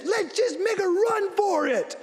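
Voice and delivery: high, slowed put-on voice for his wife; imitating his wife. Wife in heels calling for a run through the rain, high mock voice. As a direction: play the wife urging a dash through the rain. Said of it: "She says".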